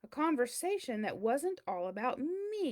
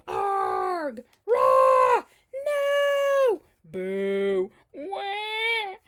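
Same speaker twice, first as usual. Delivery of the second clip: loud, high yells and wails one after another; hammed-up cries of rage and despair; burst of wild outbursts; 9 semitones higher, 12 dB louder, much slower than usual. Cheese acting out every emotion in loud hammed-up cries.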